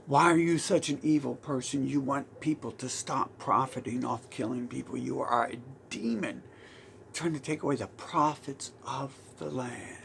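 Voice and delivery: ordinary talk, nothing marked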